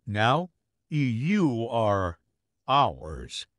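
slow, drawn-out claim of ownership; slow menace. Acted. Vampire to the neighbour, claiming them slowly and menacingly. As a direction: stretch each word out like a threat.

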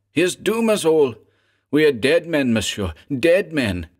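enraged. A narrator's reading. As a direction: rage at the weak heir who doomed them. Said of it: "his features contorted with rage".

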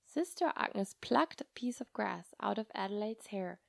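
ordinary delivery

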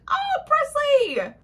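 very high, loud, drawn-out squeal; overjoyed cry. Acted. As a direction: cry out to a long-lost brother, hammed-up. Tamsin sees missing brother reappear.

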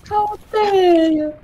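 high, slow, drawn-out how dare you; milk the fake offense, slow and high; mock outrage. Mock-offended player to teammate, high slow outrage.

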